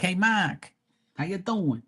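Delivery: high-pitched Boston hello; Boston accent. Retold or imitated. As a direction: imitate Boston friends, R dropped in Mark. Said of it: "That's how they say my name".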